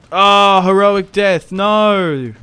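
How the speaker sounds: loud, high cry of dismay; drawn-out, high-pitched wail of protest. Real, not acted. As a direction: cry out as your hero falls. General killed in the game.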